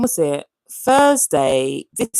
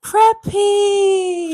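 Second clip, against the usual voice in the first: high, loud delighted call; excited greeting; 14 semitones higher, 4 dB louder than usual. Real, not acted. Spotting a viewer on her live, calling out gladly. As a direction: sing out the name with joy.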